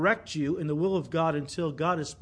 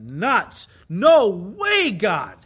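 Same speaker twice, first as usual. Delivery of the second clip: hammed-up, emphatic "no way, God"; emphatic refusal; 3 semitones higher, 10 dB louder, much slower than usual. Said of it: "I'm kind of stressing that".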